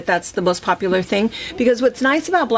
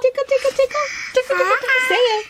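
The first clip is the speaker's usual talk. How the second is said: giggly tickle attack; much faster than usual. Acted. Tickling the baby, high voice.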